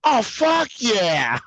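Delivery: put-on high, drawn-out drunk boast; slow, proud drunk cheer. Drunk self retold, proud.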